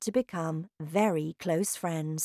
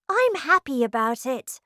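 put-on happy girl; 7 semitones higher, 4 dB louder than usual. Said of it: "Matilda said".

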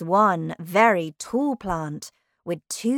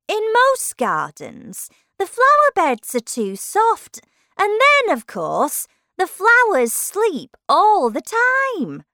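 pitched-up, projected know-it-all lily; explaining tiger lily; 12 semitones higher, 7 dB louder than usual. Acted. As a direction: explain it grandly as a talking flower.

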